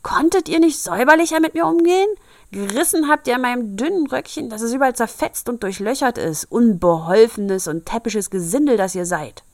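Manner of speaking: high, loud, fast shrieking dwarf; screeching dwarf. Acted. Rescued dwarf screeching at the girls, high, loud and fast. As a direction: screech out the ungrateful complaint. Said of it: "mit einer kreischenden Stimme".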